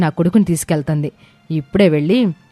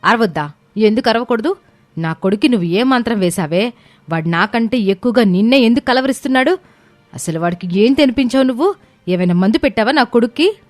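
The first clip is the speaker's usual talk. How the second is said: higher-pitched indignant questions; angry confrontation; 11 semitones higher than usual. A narrator's reading. Angry mother accuses the woman of bewitching her son, indignant. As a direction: fire accusing questions, voice rising. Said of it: "దయచేసి అరవకు అవని".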